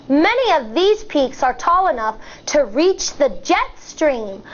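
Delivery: ordinary delivery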